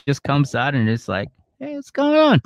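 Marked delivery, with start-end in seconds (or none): 1.6-2.4 tiny high squeak, nosy and cheerful; a peeking critter piping up, pitched way up; squeaky little creature voice. Pretend. The penis peeking out mid-argument, tiny high squeak.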